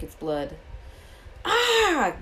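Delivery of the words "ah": high, loud "Ah!" of terror; terrified scream. A narrator's reading. Malfoy screaming at the hooded figure drinking blood, terrified scream. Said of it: "let out a terrible scream".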